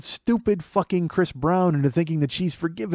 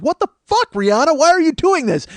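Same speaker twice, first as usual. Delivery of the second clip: very high, exasperated wail; mock outrage; 12 semitones higher, 4 dB louder than usual. Everybody at [name] over the album, mock outraged squeal. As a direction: squeal it in disbelief as the public. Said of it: "Everybody's like".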